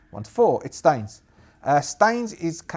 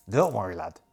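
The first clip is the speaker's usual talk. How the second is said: lower, dismissive brush-off; captain's reply; 7 semitones lower than usual. Acted. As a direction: wave him off, lower. Captain answers soldier.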